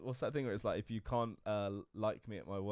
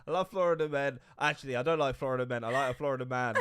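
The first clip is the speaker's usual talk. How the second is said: loud, higher mocking quote; imitating co-host; 5 semitones higher, 11 dB louder than usual. Co-host teasing host about Florida men, loud mock quote. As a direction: repeat their words back loudly in mockery. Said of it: "By the way, she went".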